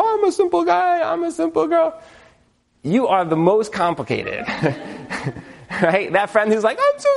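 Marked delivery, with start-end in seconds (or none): 0.0-2.0 high, sustained singsong put-on voice; mock simple folk. Teasing people who think they're the simplest, mock-innocent high voice. 6.8-7.1 very high put-on squeal of a voice; mock simple friend. Imitating that friend who claims to be simple, high mocking voice.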